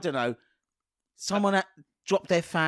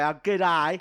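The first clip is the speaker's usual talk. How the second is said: mock Australian greeting; cartoonish Aussie; Australian accent. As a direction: play the Australian koala saying hello. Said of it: "What does he say?".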